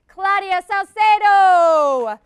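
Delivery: loud, high name call; announcing names. Real, not acted. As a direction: call each name out to the room. Host names team to applause.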